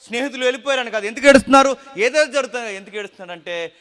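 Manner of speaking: loud, high, fervent preaching; full-force preaching. Acted. Preacher thundering to the congregation about fear, loud and fervent. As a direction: drive the point home to the congregation. Preacher asking why he wept.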